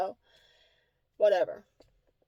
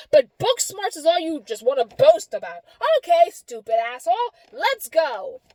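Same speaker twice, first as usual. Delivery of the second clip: worked-up, higher-pitched scolding; exasperated, emphatic tirade; emotional rant; 5 semitones higher than usual. Retold or imitated. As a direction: vent at him, fed up. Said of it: "I get emotional".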